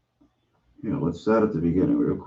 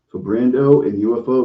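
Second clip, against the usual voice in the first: usual speaking voice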